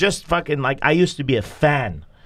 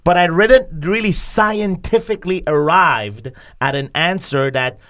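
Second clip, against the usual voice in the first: normal talking voice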